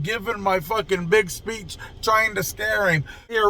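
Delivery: ordinary delivery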